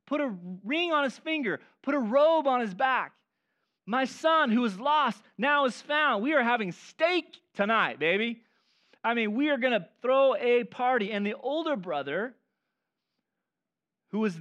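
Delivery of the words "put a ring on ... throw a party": a jubilant father calling for a party; joyful father. Retold or imitated. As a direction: announce the feast with joy. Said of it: "He says, throws a party".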